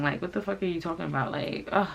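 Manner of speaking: usual speaking voice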